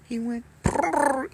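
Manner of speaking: high rolled prrr like a cat; cat trill imitation. Imitating the cat's trill to show his girlfriend. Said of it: "did you hear that?".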